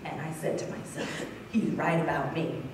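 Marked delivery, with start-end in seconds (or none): 1.5-2.6 low, soft admission to oneself; quiet realization. Retold or imitated. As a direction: let it sink in, low and subdued.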